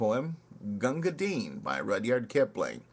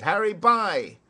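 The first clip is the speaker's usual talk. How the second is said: high, loud soldiers' shout; 10 semitones higher, 8 dB louder than usual. Acted. Thirsty troops shouting for water, loud high shout. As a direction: bellow it for the water boy. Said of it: "we shouted".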